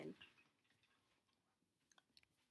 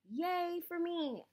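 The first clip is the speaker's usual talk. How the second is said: drawn-out cheer; 9 semitones higher, 4 dB louder, much slower than usual. Real, not acted. Celebrating getting drain out.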